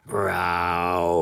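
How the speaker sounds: low growling roar; mock bear roar. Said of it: "terrible bear charging after him".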